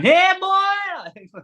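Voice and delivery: high-pitched yodelling holler; yodelled shout. Retold or imitated. Retelling a stranger grabbing him by the neck, yodelled shout. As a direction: imitate a man's wild yodel-like shout. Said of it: "Like this shouting".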